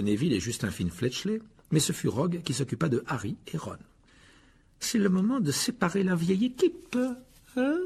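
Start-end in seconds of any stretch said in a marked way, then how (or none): 4.8-7.8 high, sneering teacher voice; mocking. A narrator's reading. Rogue splits up Harry and Ron, mocking and high. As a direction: needle them with a mocking lilt. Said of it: "dit-il d'un air narquois".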